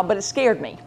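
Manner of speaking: ordinary talk, nothing marked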